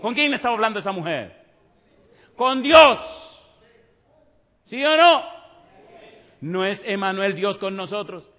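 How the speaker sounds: loud, rising preacher's appeal; fiery preaching. Acted. Preacher exhorts his congregation to live the word, loud and fervent. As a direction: press the congregation with conviction.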